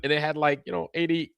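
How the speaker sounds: ordinary talk, nothing marked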